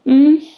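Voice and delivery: loud, high choked sob; a sob breaking through; suppressed sob. Real, not acted. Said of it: "Sorry, I'm gonna cry".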